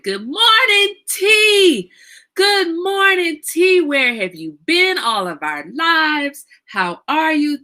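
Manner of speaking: high, loud, stretched-out hello; excited greeting. Real, not acted. Host greets long-absent friend T joining the table, real excited welcome. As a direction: sing out a delighted welcome, very high.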